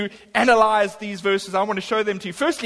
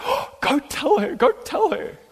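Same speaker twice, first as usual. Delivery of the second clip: high, drawn-out excited urging; urgent encouragement; 6 semitones higher than usual. Retold or imitated. Julie hears the story and urges him, excitedly. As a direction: gasp of an "oh", then urge it high.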